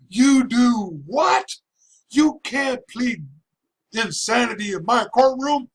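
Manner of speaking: high, slow outraged judge; angry judge. Retold or imitated. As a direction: shout it in disbelief like the furious judge. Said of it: "But he said".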